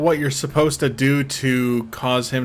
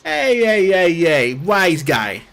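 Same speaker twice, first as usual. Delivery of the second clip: put-on higher, punchy New York mobster accent; New York wise-guy accent; 4 semitones higher, slower than usual. Streamer imitating the character's New York mobster accent. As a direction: play the Brooklyn tough guy. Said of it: "I love this accent".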